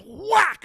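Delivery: sharp high whack, a mock slap noise; slap sound. Kid telling friends how dad hit the bully, loud whack sound. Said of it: "my dad goes".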